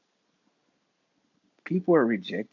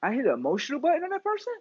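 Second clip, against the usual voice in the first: mock surprise; 12 semitones higher, much faster than usual. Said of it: "And I'd be like".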